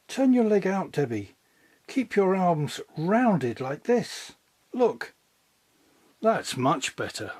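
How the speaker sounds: a gentle teacher showing the pupil, slowly; patient ballet teacher. A narrator's reading.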